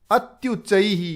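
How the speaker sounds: ordinary talk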